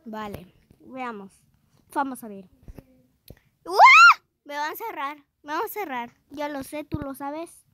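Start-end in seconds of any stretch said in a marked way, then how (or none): 3.6-4.2 startled scream. Real, not acted. Sudden fright in game.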